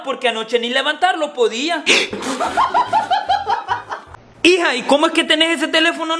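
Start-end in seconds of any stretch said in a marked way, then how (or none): 1.9-4.0 shocked gasp-laugh. Acted. Shocked reaction to father's joke.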